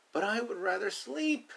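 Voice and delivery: put-on a baby duck begging to stay in bed; sleepy baby duck. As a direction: whine it like a baby who wants to sleep. Baby duck line, loud.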